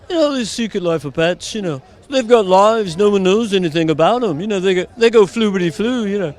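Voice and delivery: lilting Liverpool musician impression; chatty put-on Liverpudlian voice. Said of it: "what I do now is [name]".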